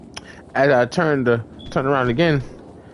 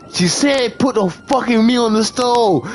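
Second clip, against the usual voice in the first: higher, insistent imitation of the man; stranger's demand; 8 semitones higher than usual. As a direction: act out his pushy repeat, pitched up. Retold stranger repeating the order.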